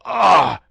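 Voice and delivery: loud high cry of agony; dying man cries out on seeing him; pained cry. A narrator's reading. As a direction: let out a sharp cry of pain. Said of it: "cried he".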